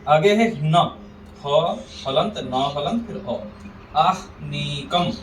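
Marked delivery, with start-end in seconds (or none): 4.0-5.1 आहनीकम very slow, as "aah-nee-kam"; ह before न. Shown as a demonstration. Teacher shows ह comes first in आहनीकम, slow demo. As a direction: stretch आहनीकम, sounding ह before नी. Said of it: "ह पहले, फिर न है".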